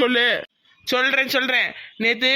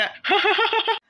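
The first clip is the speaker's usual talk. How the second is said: high-pitched scheming laugh; gloating laugh; 8 semitones higher than usual. Acted. Schemer to his friend about trapping the girl, gloating laugh. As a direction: laugh in anticipation of someone's downfall. Gloating over planned exposure.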